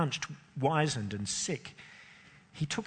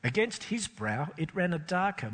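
usual speaking voice

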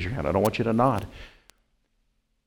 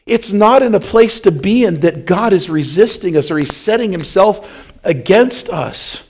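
normal talking voice

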